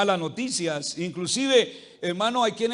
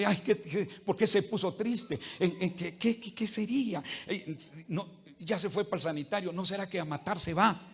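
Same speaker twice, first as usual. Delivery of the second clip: worried murmur; 7 dB quieter than usual. Retold or imitated. Fretful spouse whispers worries.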